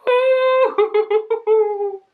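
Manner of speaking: high, loud, slow woo-hoo cheer; excited whoop. Real, not acted. Opening a plant package, high whooping cheer. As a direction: whoop it out like a fan.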